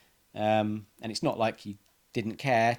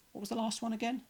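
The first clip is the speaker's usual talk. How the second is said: much higher, softer baffled question; lost puzzlement; 12 semitones higher, 7 dB quieter than usual. Retold or imitated. Stuck in the aisle, a high, soft baffled question. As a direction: sound utterly lost.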